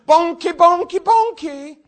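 high, loud prophetic yell; yelling a prophecy. Retold or imitated. Preacher retells yelling a prophecy at the fire tunnel, loud and high. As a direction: belt it out like a prophecy pouring out. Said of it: "I started jumping, saying".

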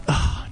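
long, breathed-out sigh; acted deflated sigh; weary sigh. Young man looks at the note next night, weary sigh.

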